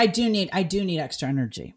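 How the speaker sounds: usual speaking voice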